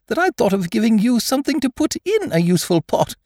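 very high, pleased squeak; happy Piglet. A narrator's reading. Piglet glad he gave something for the pot, happy. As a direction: pipe along happily in a small creature voice. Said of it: "said Piglet happily".

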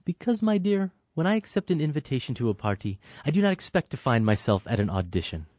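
mild hammed-up condescension; condescending. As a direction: talk down to her sweetly. Acted film scene, condescending host.

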